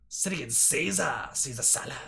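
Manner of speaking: reacting to a silly word; sudden realisation. Real, not acted. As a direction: catch the funny misreading. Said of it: "realises the word slip".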